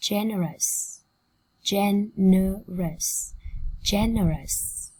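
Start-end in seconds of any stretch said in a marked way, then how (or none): 1.6-3.3 very slow GEN-E-ROUS, syllables apart. Shown as a demonstration. Teacher modelling generous for students, slowly split up. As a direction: sound out Generous slowly, one syllable at a time. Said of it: "Cô giáo đọc chậm nhá".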